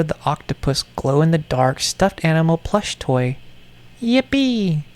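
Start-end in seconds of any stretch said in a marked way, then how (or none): 4.0-4.8 very high, squeaky cheer; high giddy yippee. Acted. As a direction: squeal it like a delighted child.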